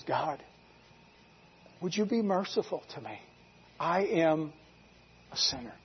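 very soft, halting prayer; humble plea. Retold or imitated. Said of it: "He just says".

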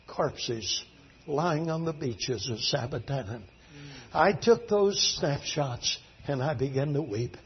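low, subdued and soft; quiet, sorrowful telling; hushed grief. Real, not acted. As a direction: let the voice sink as you mourn. Grieving over the dead islanders.

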